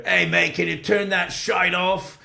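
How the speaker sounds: cheeky British rocker barking at the bartender. Retold or imitated. British rocker telling bartender to kill the music, gruff. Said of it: "And he says to the bartender".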